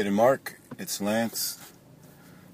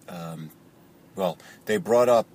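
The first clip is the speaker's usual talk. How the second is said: ordinary delivery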